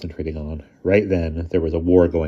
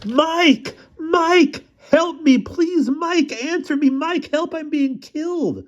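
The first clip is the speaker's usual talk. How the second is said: yelling at the top of lungs; much slower than usual. Acted. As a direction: scream to wake Mike up. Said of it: "at the top of my lungs I yelled".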